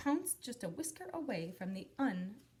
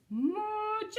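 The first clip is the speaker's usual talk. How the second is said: high, loud call; 13 semitones higher, 7 dB louder, slower than usual. Acted. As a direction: call across the distance. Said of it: "he called out".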